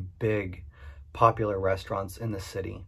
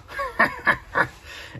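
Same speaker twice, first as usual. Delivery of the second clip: high breathy burst of laughter; busting up laughing at the mentor's rude line. Real, not acted. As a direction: crack up laughing. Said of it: "I busted up laughing".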